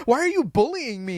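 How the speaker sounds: high whiny plea; imitating the girl. Podcaster retelling the sketch girl's punchline, high and whiny. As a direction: play the wounded victim. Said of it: "she goes".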